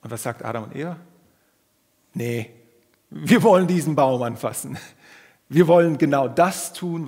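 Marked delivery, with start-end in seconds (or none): 2.1-7.1 loud, defiant demand; defiant shout. Acted. Preacher voicing Adam and Eve, loud and defiant. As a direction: shout it like stubborn children.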